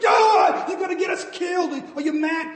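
high, loud alarmed yell at the driver; panicked shout. Retold or imitated. Passenger yelling at reckless driver, panicked.

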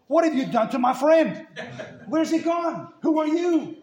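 high, loud, incredulous questions; mock bewilderment. Friend confronts pal whose accent suddenly changed, mock bewilderment. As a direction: play up baffled alarm, pitch jumping up.

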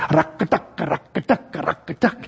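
soft rhythmic rock-a-duck creak; quiet toy creak, rising each time; rocking horse creak. Retold or imitated. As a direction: mimic the creaking horse softly. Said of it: "started to make a loud noise".

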